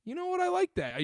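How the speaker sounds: a touch of pleased surprise; mock impressed viewer. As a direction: nod along like a fan who's sold. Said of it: "I go".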